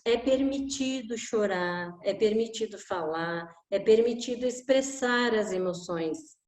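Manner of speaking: ordinary talk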